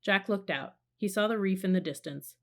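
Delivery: usual speaking voice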